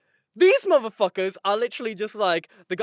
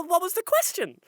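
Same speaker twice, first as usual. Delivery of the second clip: high-pitched mock confusion; acted incredulous question; baffled exasperation; 8 semitones higher than usual. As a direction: ask it in comic bewilderment.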